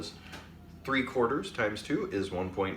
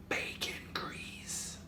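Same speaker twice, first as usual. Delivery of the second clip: slow unvoiced whisper; 10 dB quieter, much slower than usual. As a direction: breathe it out like a secret. Secret ingredient whisper.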